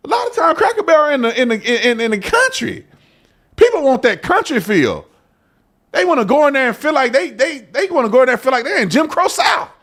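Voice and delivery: high, rising rant at the CEO; worked-up rant. Real, not acted. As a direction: push the pitch up, exasperated. Ranting about the rebrand.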